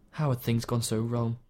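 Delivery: ordinary delivery, nothing marked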